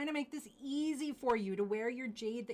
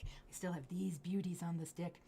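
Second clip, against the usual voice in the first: hushed aside; whispered aside; 3 semitones lower than usual. Real, not acted. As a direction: whisper to herself. Aside while finding earrings.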